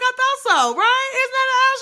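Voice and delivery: high, loud excited squeal; high, loud delighted quote. Retold or imitated. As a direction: squeal it out, thrilled to be right. Retold excited self to friend.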